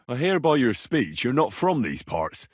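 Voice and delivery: ordinary talk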